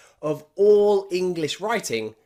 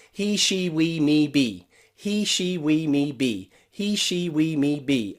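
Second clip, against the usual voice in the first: chain the words in a quick rhythmic drill; faster run-through. Shown as a demonstration. Teacher shows learners how to practice faster, run-through drill. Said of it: "practice that better or faster, try going through all of them".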